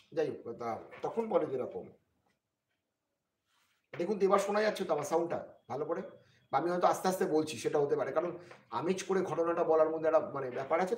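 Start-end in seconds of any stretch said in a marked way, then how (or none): 4.0-11.0 louder, higher, quicker check with listeners; raised voice. Real, not acted. Streamer asking listeners if the sound carries, raised voice. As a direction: speak up so the stream can hear.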